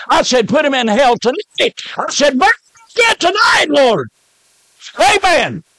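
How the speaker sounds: high, climbing cry to the Lord; fervent prayer shout. Retold or imitated. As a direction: re-enact a fired-up prayer, peaking in a shout. Acts out his fervent prayer over Murray.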